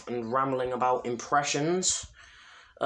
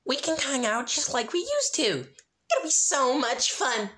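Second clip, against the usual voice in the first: much higher, bubbly and cheerful; Pinkie Pie voice; 12 semitones higher than usual. Acted. Pinkie inviting Rainbow to hang out, much higher and bubbly. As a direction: chirp it like a hyper party pony.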